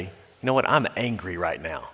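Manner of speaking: a pastor modelling calm self-talk, quietly. Pretend. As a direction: admit it softly to yourself. Voicing calm self-talk in anger.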